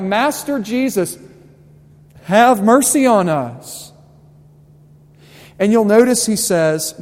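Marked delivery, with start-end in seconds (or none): 0.0-3.9 louder, higher pleading call with mercy stretched out; crying out. Retold or imitated. Lepers calling to Jesus from a distance, loud pleading. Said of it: "they're crying out".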